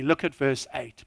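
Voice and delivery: ordinary talk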